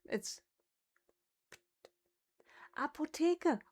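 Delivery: ordinary talk, nothing marked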